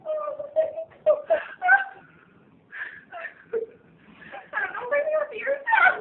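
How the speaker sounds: high, tearful sobbing words; real tears of joy; happy crying. Real, not acted. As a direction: cry out in overwhelmed joy. Crying over positive pregnancy test.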